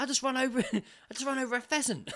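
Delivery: high, lightly laughing imitation of a stunned driver; mock high shocked friend; retold shocked mate. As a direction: replay the driver's disbelief. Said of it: "He's like".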